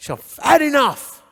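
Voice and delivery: retold angry ultimatum, drawn out; fed-up outburst. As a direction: act out someone who has finally snapped. Fed-up prodder's acted outburst.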